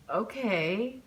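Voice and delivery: unsure, rising okay; a drawn-out, doubtful okay; hesitant okay. A narrator's reading. Hesitant before agreeing.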